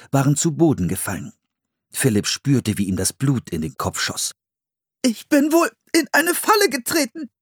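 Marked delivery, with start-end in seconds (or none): 5.0-7.3 put-on high, squeezed child's voice; strained voice. A narrator's reading. Boy hanging upside down in a trap, strained and high. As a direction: squeeze the words out under strain. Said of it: "sagte er mit gepresster Stimme".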